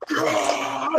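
high growling snarl at him; demon growl. Retold or imitated. Possessed man in Home Depot growls at him, high snarl.

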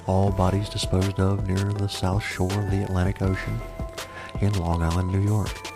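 spooky fireside storyteller; horror host. Acted. ASMR murder podcast host intro.

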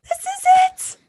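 triumphant cheer. Retold or imitated. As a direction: celebrate like you just won.

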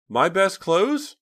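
put-on unsure little girl checking; uncertain. A narrator's reading. Lisa on the phone, uncertain and girlish. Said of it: "Lisa sounded uncertain".

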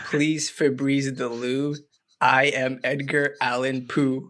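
slow, dramatic poem read. Acted. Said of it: "let me read it all at once".

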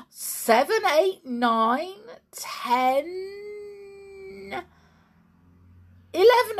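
rising, stretched excitement towards the last number; mounting count. Acted. Counting up puffs with mounting suspense.

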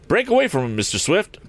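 high, urgent driver; directing. A narrator's reading. Eradicate urges Tom to break away, directing. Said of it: "directed the man".

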